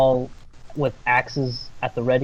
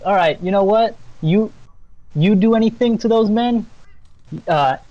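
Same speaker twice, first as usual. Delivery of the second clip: higher, louder acted warning; angry threat; 5 semitones higher, 4 dB louder than usual. Lumberjack warns nymph off his men, acted angry threat. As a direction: warn them off with rising heat. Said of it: "the guy's like".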